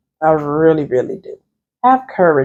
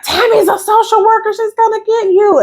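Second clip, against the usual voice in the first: teasing teacher. Retold or imitated. Teacher teasing the veteran, high mocking call. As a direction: squeal it like a teacher ribbing the class. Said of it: "And he was like".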